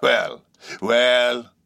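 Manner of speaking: high-pitched seaman, slowing down; musing sailor stranger; eccentric old sailor, resigned. A narrator's reading. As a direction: draw it out with an ominous shrug. Stranger muses on signing.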